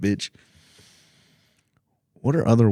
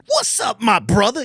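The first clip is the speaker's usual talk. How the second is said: mock bro greeting; 12 semitones higher than usual. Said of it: "I'm like".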